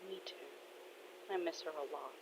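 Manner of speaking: very soft, subdued reply; hushed sorrow. Acted. Dad agreeing he misses her, hushed sorrow. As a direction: let it sink almost to nothing. Father shares the grief.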